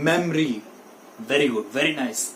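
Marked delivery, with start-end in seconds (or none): 0.1-0.6 memory in two syllables, mem-ree; correct pronunciation. Shown as a demonstration. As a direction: drop the middle o in memory. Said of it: "Say it with me". (memory का सही उच्चारण, मेम-री)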